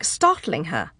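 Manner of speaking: ordinary delivery, nothing marked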